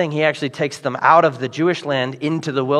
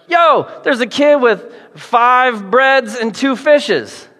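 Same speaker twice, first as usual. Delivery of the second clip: casual blurt; 7 semitones higher, 3 dB louder, slower than usual. Retold or imitated. Andrew blurting idea.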